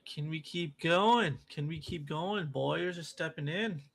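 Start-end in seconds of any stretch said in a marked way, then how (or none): 0.0-1.4 louder and slow, pleading with the market; loud drawn-out plea. Real, not acted. Streamer begging the trading chart, loud and slow. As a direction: stretch it out, begging the price to climb.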